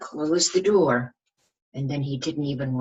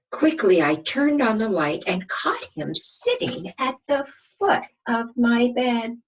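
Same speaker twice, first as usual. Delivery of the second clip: higher, louder sudden storybook narration; dramatic storybook reading; 10 semitones higher, 6 dB louder than usual. A narrator's reading. Teacher reading the climax to children, higher and louder storybook voice. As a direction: burst into the big moment of the story. Teacher reads picture book aloud.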